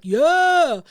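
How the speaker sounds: called out from the back. Retold or imitated. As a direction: holler it from the back of the room. Man at the back calls out.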